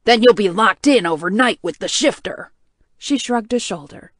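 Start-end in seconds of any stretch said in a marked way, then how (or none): 0.0-2.5 angry. Acted. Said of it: "He was glaring at her".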